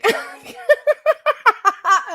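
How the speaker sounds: squealing burst of laughter; giggling at her own joke; high loud laugh. Real, not acted.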